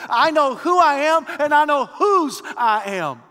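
loud, high, ringing sermon voice; preaching at full force. Acted. Preacher exhorting the Christmas congregation, loud and high. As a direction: proclaim it to the whole church.

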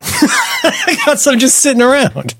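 high laughing voice. Real, not acted. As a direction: laugh through the line. Laughing joke about bitter herbs.

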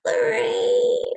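high, drawn-out granny call; creepy old granny voice. Retold or imitated. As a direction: call the name like a ghostly old woman. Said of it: "let's see if I can do it real quick".

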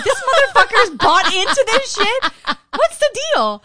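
high, loud, slow laughing outrage; laughing rant. Retold or imitated. Telling her cohost she was played, laughing loudly. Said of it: "I'm like".

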